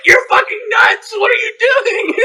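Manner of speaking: high, shouted mock disbelief; loud, squealing retold alarm; shocked onlooker. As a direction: imitate a freaked-out bystander.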